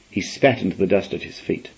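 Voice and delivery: usual speaking voice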